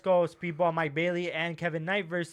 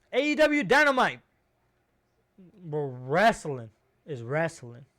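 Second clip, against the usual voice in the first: normal talking voice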